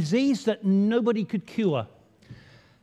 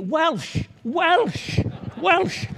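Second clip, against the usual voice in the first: calling out; 8 semitones higher, much slower than usual. Real, not acted. Calling out for Welsh speakers.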